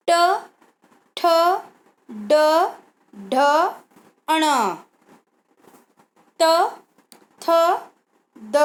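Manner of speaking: each letter called out clearly with pauses; reciting consonants. Shown as a demonstration. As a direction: recite the alphabet for children. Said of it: "आप बोल कर देखेंगे".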